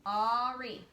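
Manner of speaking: "Ari" held long in two beats; slow, measured "Ari"; split into syllables. Shown as a demonstration. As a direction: draw out both halves of "Ari". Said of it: "Two word parts".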